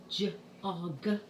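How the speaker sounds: "j-og" split into juh, ah, guh; sounding out. Shown as a demonstration. Teacher repeating a pupil's sounds, segmented one by one. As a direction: separate each sound in "j-og".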